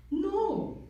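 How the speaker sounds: high, drawn-out mother voice; consoling mom. Acted. Single Indian mom to son called dumb, comically consoling. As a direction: play Mom soothing, then landing a backhanded comfort. Joke voicing his mom's consolation.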